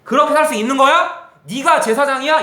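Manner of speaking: indignant, high-pitched telling-off; shouted rebuke. Acted. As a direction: thunder at a son as a stern father. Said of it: "소리 좀 크게 지를게요".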